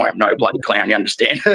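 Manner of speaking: low, gruff Aussie telling off a reporter; imitating a fed-up Australian coach; Australian coach impression. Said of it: "it was very Australian".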